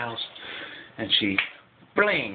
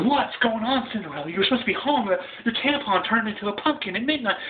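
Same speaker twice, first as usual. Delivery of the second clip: high, scolding fairy godmother; 11 semitones higher than usual. Retold or imitated. Godmother scolding late Cinderella, high-pitched. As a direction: demand an answer, pitched up high. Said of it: "fairy godmother goes over and says".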